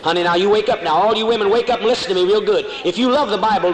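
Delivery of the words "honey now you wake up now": ordinary talk, nothing marked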